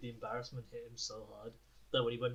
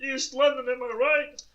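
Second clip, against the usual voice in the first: much higher and louder hammed-up London voice; jokey imitation of a brash East Londoner; mock East London lad; 12 semitones higher, 9 dB louder, slower than usual. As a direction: take the mick out of a loud Londoner. Mocking the party guy's voice.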